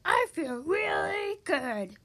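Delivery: slow, high, drawn-out happy boom; cheerful boom. Acted. Dragon delighted after its good deeds, slow cheerful boom. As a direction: stretch each word in booming delight. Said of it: "he boomed cheerfully".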